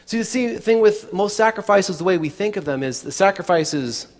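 ordinary talk, nothing marked

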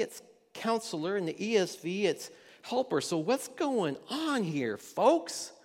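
ordinary delivery

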